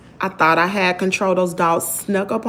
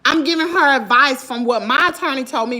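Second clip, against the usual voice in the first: ordinary delivery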